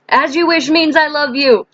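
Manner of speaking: high, quick-moving exclamation; playful quote. Pretend. Playful film quote, said twice.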